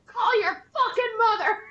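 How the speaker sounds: high, loud furious order through a laugh; retold yell. Wife yelling at the soldier over the phone, angry order. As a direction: scold him like a mother would.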